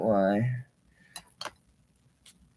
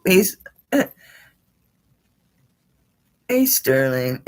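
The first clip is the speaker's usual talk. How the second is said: ordinary delivery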